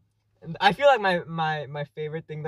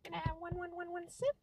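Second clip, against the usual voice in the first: very high and much softer, pleading; high tiny voice; 11 semitones higher, 14 dB quieter than usual. Pretend. Copying the other's begging for a sip, high and soft. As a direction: beg in a tiny high squeak. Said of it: "I can do pretty high voices".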